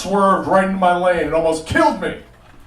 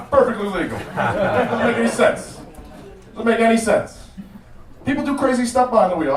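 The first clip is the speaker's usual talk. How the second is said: normal talking voice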